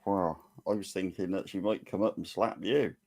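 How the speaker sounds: ordinary talk, nothing marked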